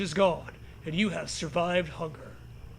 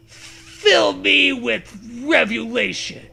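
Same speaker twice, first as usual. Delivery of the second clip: mock scream; 4 semitones higher, 8 dB louder, slower than usual. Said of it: "You scream at the sky".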